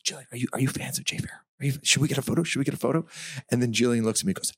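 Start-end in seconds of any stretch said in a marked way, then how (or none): 0.0-3.0 momager. Retold or imitated. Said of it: "I was playing momager".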